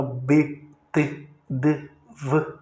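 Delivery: B, T, D, V as short separate sounds with barely any vowel; bare consonant sounds. Shown as a demonstration. Teacher showing consonants need a vowel, bare sounds. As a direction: give each consonant alone, obstructed. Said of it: "pronunciadas com algum obstáculo".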